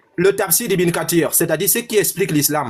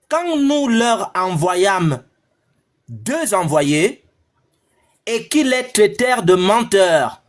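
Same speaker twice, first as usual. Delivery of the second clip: slow solemn scripture reading; much slower than usual. Acted. Reads the Quran verse aloud.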